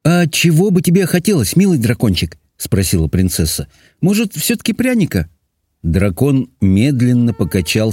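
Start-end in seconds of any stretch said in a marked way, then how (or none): 0.0-2.4 high sweet princess question; gentle high-pitched coaxing. A narrator's reading. Princess coaxes the weeping dragon. 4.0-5.3 high-pitched sweet offer; girlish, hopeful princess question; coaxing princess. A narrator's reading.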